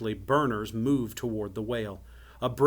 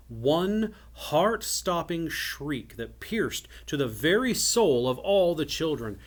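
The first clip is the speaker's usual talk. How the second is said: ordinary delivery, nothing marked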